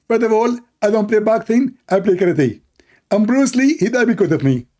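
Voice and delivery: high mock debater. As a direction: brag like a self-important fighter. Mock opponent boasts.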